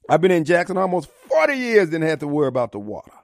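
ordinary talk

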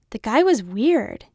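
dad grumbles to daughter, mild snarl. A narrator's reading. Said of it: "he said with a snarl".

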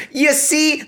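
bright door-to-door salesman opener; salesman pitch. Retold or imitated. Mock salesman pitch, Harry to Slughorn. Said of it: "he sounds like a fucking, like, door-to-door salesman".

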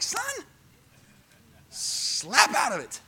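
slow, breathy stern rebuke; stern God. Pretend. God rebuking Elijah, slow stern whisper. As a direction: scold him slowly under the breath. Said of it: "he just wants to be like".

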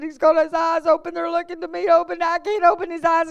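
panicked prayer. Retold or imitated. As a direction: rush it out in mock panic.

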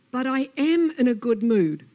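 loud, emphatic snake protest; exasperated insistence. Acted. Snake contradicts Lizard, loudly insistent. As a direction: hit "am" hard and loud.

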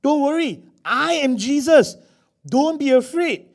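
loud, high, drawn-out reassurance; ringing, emphatic calming call; Jesus reassuring. Acted. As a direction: call out slowly to reassure them. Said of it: "He had to reassure them".

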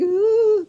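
high, drawn-out nervous whimper, acted; small high noise of kid dread; uneasy "ah". Kid waiting through Stan Lee's pause, uneasy. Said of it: "feeling like something is wrong".

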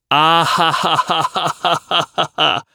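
laughing. Acted. Acted laugh in read chat.

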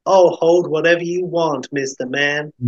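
lifted character voice, warming to him; waitress warming up. Acted. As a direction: flirt like a diner waitress. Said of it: "warming to him further".

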